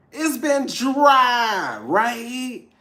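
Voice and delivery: very slow, high, loud shout; drawn-out shout. Acted. Tarot reader shouting the joke back to viewers, slow and high. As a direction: stretch it out in a big playful yell. Repeats the line louder.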